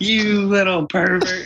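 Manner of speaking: wife walks in and scolds him, slow and high-pitched; mocking wife. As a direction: play the wife catching him, drawn out. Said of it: "She's like".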